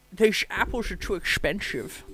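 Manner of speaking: put-on slow, slurred Scottish accent with shushed s; failed Dutch accent that lands Scottish; exaggerated Scottish accent, every s turned to sh; Sean Connery. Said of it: "Sean Connery".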